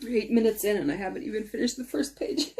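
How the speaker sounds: ordinary delivery, nothing marked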